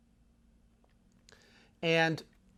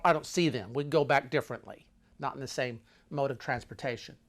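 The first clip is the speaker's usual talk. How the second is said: ordinary delivery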